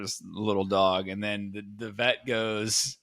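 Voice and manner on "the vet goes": ordinary talk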